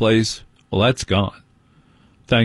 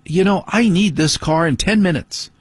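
higher voice, mock wife demanding the car; imitating wife; 8 semitones higher than usual. Husband mimics his wife calling him in from the car. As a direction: mimic the wife's impatient reminder. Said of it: "my wife coming out going".

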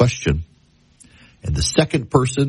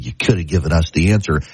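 imitating a snide classmate; 8 semitones lower, faster than usual. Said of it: "made some snide remark".

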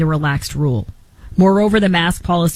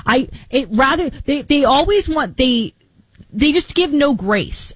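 rising heat, pitched up and pressing; worked up; 7 semitones higher than usual. Real, not acted. Ranting to Taylor about people with no grace, worked up. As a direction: let the frustration climb higher.

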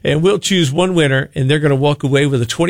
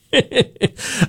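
short laugh; 6 semitones higher than usual. Real, not acted. Computer went ballistic.

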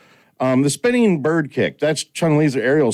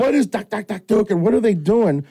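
confused surprise; 8 semitones higher than usual. Retold or imitated. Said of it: "and I'm like".

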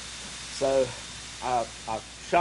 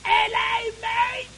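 hammed-up British greeting, shouted high and loud; joking fake British accent, yelled much higher and louder; mock British accent; 5 dB louder, much slower than usual. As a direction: bellow a cartoon British hello, way up high. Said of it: "I've been working on my British accent".

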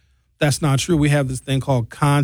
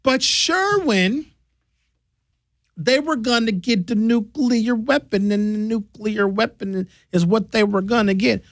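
mock opponent; 7 semitones higher, slower than usual. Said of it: "But then they'll say".

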